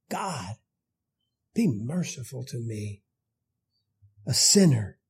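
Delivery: soft, pleading plea; humble prayer. Retold or imitated. Publican face down before God, softly pleading. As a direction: hush it like a broken man praying.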